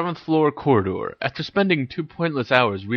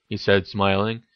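ordinary delivery

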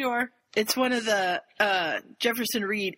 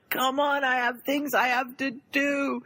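exasperated; 6 semitones higher, slower than usual. Retold or imitated. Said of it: "I'm swearing at it".

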